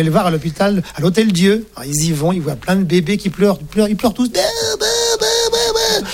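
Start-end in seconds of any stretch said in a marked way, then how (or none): 4.3-6.1 put-on crying baby. Crying babies in the ward.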